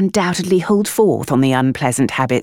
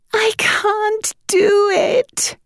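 high, slow, drawn-out wail; a despairing mother's wail; much higher, slow theatrical wail; much slower than usual. A narrator's reading. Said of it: "Winifred wailed by way of greeting".

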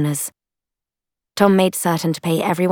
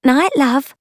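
high female character goodbye; woman companion's voice; 10 semitones higher, 5 dB louder than usual. A narrator's reading. Woman winks and says goodnight to Tom, high female voice. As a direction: lift it high for a cheeky farewell.

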